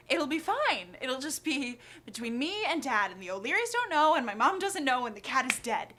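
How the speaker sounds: loud, swooping mock reassurance; talking yourself down while panicking; frantic self-reassurance. Child anxiously reassuring self, acted.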